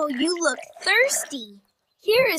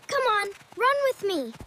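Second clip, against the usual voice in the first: normal talking voice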